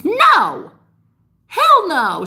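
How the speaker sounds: high, loud outburst of refusal; indignant yelp of no; emphatic no. Real, not acted. Rejecting undeserving feminines.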